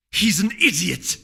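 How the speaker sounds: high, loud, slow furious cry; angry outburst. Acted. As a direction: spit out the insult in a rage. Celerity ranting about Blue Blood.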